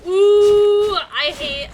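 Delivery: loud high ooh; excited ooh. Real, not acted. Excited interjection.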